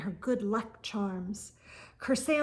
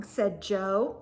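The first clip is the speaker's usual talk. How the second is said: ordinary delivery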